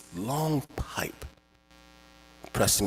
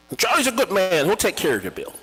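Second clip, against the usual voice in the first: higher, louder neighbor vouching; 9 semitones higher, 5 dB louder, faster than usual. Retold or imitated. Neighbor reassuring the father about Charlie. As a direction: sell Charlie as a good man.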